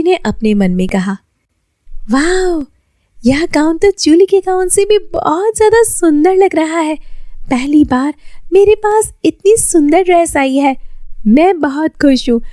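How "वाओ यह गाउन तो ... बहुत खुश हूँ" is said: high, bright wonder at the new gown; Lily admiring her gown, excited and higher; delighted girl. Acted. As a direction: gasp with joy at your own work.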